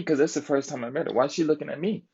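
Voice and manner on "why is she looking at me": incredulous. Retold or imitated. Vlogger retelling how he asked the girlfriend why she stared, higher and incredulous. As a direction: pitch leaps much higher, baffled. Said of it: "I'm like".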